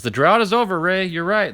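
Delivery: real relief at the drought ending; excited. Real, not acted. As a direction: tells Ray the long drought is over, triumphant. Finally got an autograph.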